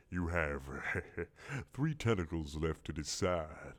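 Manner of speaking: sly monster ultimatum; menacing low squid. Acted.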